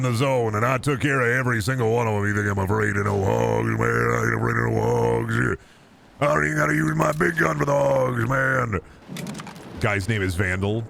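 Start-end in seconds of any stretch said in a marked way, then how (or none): 2.3-8.8 tough guy. Acted. Tough-guy bravado about hogs.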